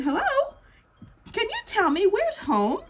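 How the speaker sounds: high, small penguin voice; lost little penguin asking the whale. Acted. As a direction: ask sweetly like a tiny chick.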